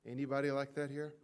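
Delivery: normal talking voice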